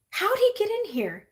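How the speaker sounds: high startled question; child bursting in, startled; surprised shout. A narrator's reading. Nor startled by the monkey chaos, high surprised cry. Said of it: "said Nor".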